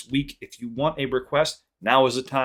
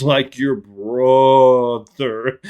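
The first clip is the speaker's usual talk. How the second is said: very slow, louder "brother" stretched; slow-motion quote; 3 semitones higher, 11 dB louder, much slower than usual. Retold or imitated. Dad finishing the line, slow-motion voice. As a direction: drag it out like a slowed replay. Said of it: "it all happened in slow motion".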